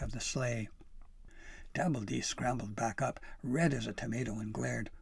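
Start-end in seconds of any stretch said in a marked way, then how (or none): none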